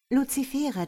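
plain reading voice

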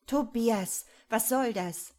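sharp, loud scolding mother; brusque. A narrator's reading. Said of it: "fragt sie barsch".

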